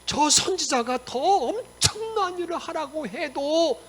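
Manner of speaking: loud, high, drawn-out imploring; acted pleading. Servants implore Naaman to wash seven times, loud acted pleading. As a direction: beg earnestly, stretching out the slow words.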